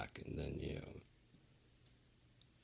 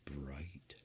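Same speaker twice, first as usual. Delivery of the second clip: soft, unvoiced whisper; 2 semitones lower, 11 dB quieter than usual. Real, not acted. Streamer whispering about the bright light. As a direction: whisper the word.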